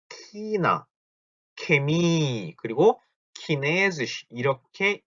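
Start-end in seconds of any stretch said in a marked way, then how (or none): 0.1-2.5 "키나" and "케미" opened with a hard k; drawn-out "키나", "케미", starting on k instead of ch; southern German pronunciation. Shown as a demonstration. Teacher shows the southern variant, hard k in "키나" and "케미". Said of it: "남부 지방에서는 이거를 갖다가". 3.3-4.3 "키네즈시" begun with a hard k; southern German pronunciation. Shown as a demonstration. Teacher shows the southern reading, hard k on "키네즈시". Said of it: "남부 지방에서는 이거를 갖다가".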